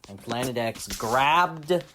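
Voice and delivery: ordinary delivery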